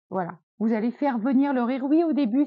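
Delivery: ordinary talk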